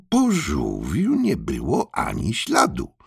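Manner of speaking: ordinary delivery, nothing marked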